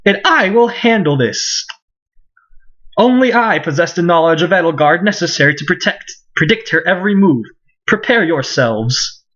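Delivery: theatrical, self-assured boast; pompous nobleman. Acted. Ferdinand vows to handle Bernadetta, pompous theatrical voice. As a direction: declare it grandly, like a noble hero.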